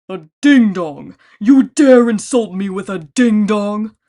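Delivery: loud, slow insulted giant roar; outraged roar. A narrator's reading. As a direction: bellow at the insult. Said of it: "He roared in outrage".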